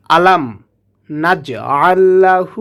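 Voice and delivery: আলাম, নাজ, আল্লাহু said in separate pieces; broken up word by word. Shown as a demonstration. Teacher breaking the verse into parts for learners. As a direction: chop it into pieces with pauses. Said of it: "তাহলে আমরা ভেঙে ভেঙে পড়ব".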